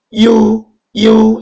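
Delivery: 이유 glided into one long, high, loud syllable; teacher modelling 이유, high, loud and sustained; pronunciation demo. Shown as a demonstration. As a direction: sound out 이유 on a high level tone, stretching it. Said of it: "이유 이렇게 읽어야 됩니다".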